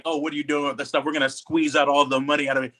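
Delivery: ordinary talk, nothing marked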